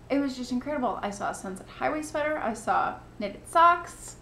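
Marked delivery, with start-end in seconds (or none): none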